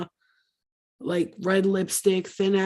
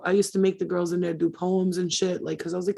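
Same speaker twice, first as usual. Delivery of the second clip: ordinary delivery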